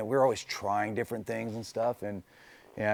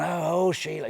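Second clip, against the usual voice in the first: a lightly voiced mock Australian, pitched up; imitating an Englishman's jokey Australian accent; 8 semitones higher than usual. A friend from England crooning to the race car in a mock Australian accent. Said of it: "He was doing it as Australian voice".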